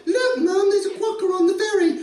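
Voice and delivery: put-on a kid tugging at mum's sleeve; excited little kid. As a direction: pipe it up like an eager child. Child voiced in the story.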